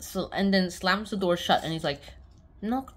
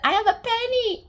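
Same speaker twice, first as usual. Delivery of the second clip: put-on high, loud boyish exclamation; hammed-up excited kid; excited announcement; 6 dB louder than usual. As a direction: blurt it out proudly, pitched way up. Boy announces his penny.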